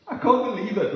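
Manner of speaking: loud, high-pitched disbelief; overwhelmed amazement. Acted. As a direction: act out being amazed by the news. Moved receiver of a prophecy, acted.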